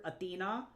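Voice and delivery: Athena as ah-thee-NAH; modern Greek pronunciation. Shown as a demonstration. Streamer reads Athena to chat, modern Greek pronunciation. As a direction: lift the final A of Athena. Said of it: "it's Athena because there's an accent".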